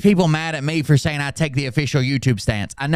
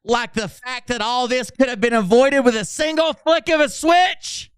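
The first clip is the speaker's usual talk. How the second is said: indignant mock outburst, higher, ending in a shout; mocking Phil; 8 semitones higher than usual. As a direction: ham up Phil's outrage, peaking on switch. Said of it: "Phil Godlewski says".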